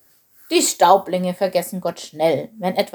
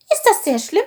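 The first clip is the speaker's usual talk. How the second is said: high little voice, a touch meek; sheepish question; 11 semitones higher, 5 dB louder than usual. A narrator's reading. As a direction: ask sheepishly in a small high voice. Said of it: "fragte Chilp kleinlaut".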